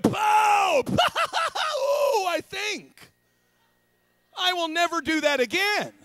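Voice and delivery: high, loud comic outburst; hammed-up high holler; mock shouting. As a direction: yell it high and big for laughs. Acting out a punished kid.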